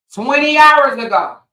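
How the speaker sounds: high, loud emphatic repeat. Acted. To the audience, repeating the hours, loud emphatic call. Stressing the late post.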